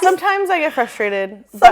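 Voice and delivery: giggling through the words; laughing. Real, not acted. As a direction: laugh while you admit it. Laughing confession to friends.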